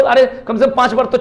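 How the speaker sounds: ordinary talk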